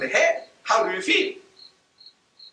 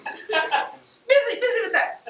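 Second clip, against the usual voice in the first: laughing mockery; much slower than usual. Real, not acted. Mocking fuss over mustaches.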